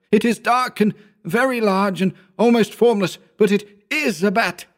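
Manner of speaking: high, weeping admission; choked with terror; sobbing. A narrator's reading. Howard confessing what he sees, high and sobbing.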